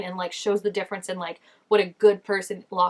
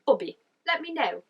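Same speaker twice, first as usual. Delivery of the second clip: condescending; 5 semitones higher than usual. Retold or imitated. Vlogger mimicking George talking down to Bobby, lightly condescending. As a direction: talk down to him, lightly. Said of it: "so condescending".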